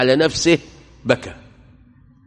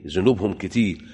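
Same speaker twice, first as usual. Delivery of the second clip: usual speaking voice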